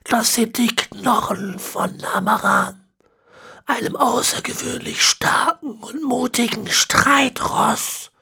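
put-on high, slow, whispery old man; ancient villain. A narrator's reading. Ezekiel explaining the horse bones, high and whispery. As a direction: rasp out the words like a centenarian.

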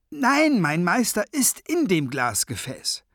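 high, loud burst on the first word; high-voiced zombie servant protesting; indignant correction. A narrator's reading. Thrasher corrects the wizard.

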